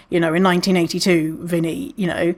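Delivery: ordinary talk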